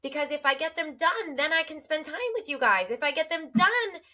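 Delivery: high-pitched, mock-frantic run-on self-talk; spiraling. Mother spiraling over her to-do list, mock frantic. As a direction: act out getting wound up, pitched high throughout. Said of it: "watch myself spiral into".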